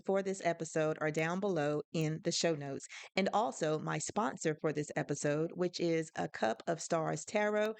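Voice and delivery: a lightly voiced upbeat sponsor read; podcast ad read. Host plugs a tarot sponsor to listeners, lightly upbeat ad read. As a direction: slip into a quick host plug. Sponsor and show notes break.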